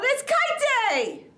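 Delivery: high, loud joyful cry; excited shout. Acted. Bear shouting to the world that it's kite day, high and loud. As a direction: shout it out with joy.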